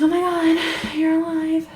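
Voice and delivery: high, loud gushing squeal of relief; mock overjoyed shock. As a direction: imitate a thrilled, over-the-top reunion cry. Retold joyful shock at seeing him.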